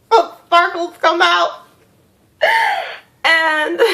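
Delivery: high, laughing through the words. Real, not acted. Telling viewers about her hand magic, laughing. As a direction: giggle at how silly it sounds.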